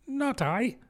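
high, soft little denial; hasty denial. A narrator's reading. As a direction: deny it hastily in a high voice. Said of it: "said the Lory hastily".

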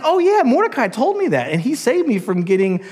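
high, surprised realization; excited royal discovery; delighted king. Acted. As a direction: gush like a king just remembering. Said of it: "And he's like".

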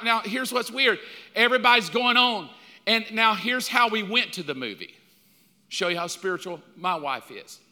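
normal talking voice

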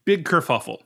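ordinary delivery

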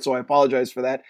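ordinary talk, nothing marked

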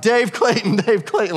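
mock third-person name announcement; pompous self-announcer. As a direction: announce yourself like a self-important guy, half laughing. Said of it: "I'm like".

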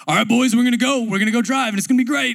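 put-on a teen driver's coach hyping up his boys, loud and high; intense football coach. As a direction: bark it like a fired-up football coach. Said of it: "he's just kinda like".

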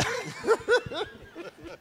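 breathy fit of laughter; laughing. Real, not acted. Still laughing at the shipwreck joke, giggling. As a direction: keep chuckling, can't stop.

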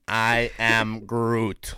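very slow, drawn-out Groot line; Vin Diesel. Acted. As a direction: stretch each word out in a mock climax. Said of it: "Vin Diesel climaxing".

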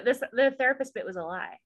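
ordinary talk

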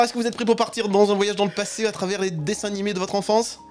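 normal talking voice

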